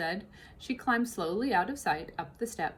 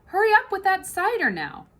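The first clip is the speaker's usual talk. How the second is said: higher, louder hurry; impatient mistress and cook; 11 semitones higher, 8 dB louder than usual. Acted. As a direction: mistress nags, cook answers. Bossing Mabel.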